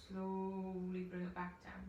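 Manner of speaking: slow, drawn-out guidance; slow guided voice. Shown as a demonstration. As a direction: stretch the words out slowly. Guiding a slow exhalation.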